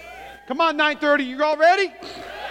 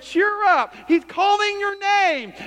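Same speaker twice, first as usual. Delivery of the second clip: high, celebratory cheer; elated crowd member, stretching the news; excited encouragement; 8 semitones higher, slower than usual. Acted. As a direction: cheer them on with a much higher voice. Acts out crowd now encouraging him.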